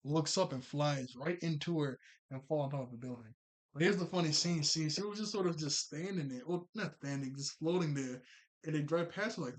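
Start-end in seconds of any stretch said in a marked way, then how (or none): none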